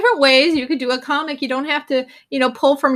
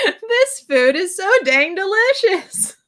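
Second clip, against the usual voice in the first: mock dog-man delight; 6 semitones higher, slower than usual. Dog men eating in comic.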